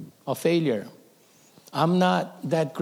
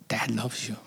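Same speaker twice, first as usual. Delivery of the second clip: whispered, much softer; a father whispering love to his frightened son; tender whisper; 4 semitones lower, 7 dB quieter than usual. Retold or imitated. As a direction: breathe it close to his ear.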